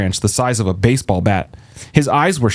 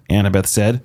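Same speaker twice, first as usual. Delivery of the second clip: usual speaking voice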